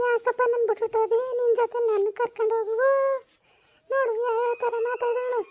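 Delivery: high-pitched, hammed-up crying complaint; tearful wailing. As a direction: wail to your son as the wronged mother-in-law. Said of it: "ಹಿಂಗ್ ಅಳ್ತಿದ್ದಿ". (ಗೋಳಾಡುತ್ತಾ ಅಳುವ ದೂರು)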